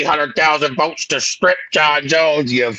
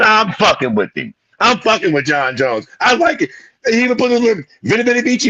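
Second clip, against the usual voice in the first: ordinary talk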